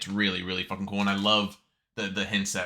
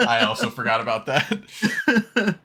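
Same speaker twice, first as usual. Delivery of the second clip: slow, louder words squeezed through laughter; laughing admission; 4 semitones higher, 6 dB louder, slower than usual. Real, not acted. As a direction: confess it while cracking up. Admits forgetting, cracking up.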